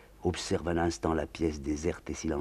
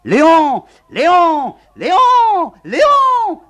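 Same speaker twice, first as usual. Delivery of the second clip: shrieked, much higher and louder, rising each time; general's wife shrieking madly; screaming herself hoarse; 19 dB louder, much slower than usual. Acted. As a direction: scream into the silent house. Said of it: "s'égosillant dans le silence".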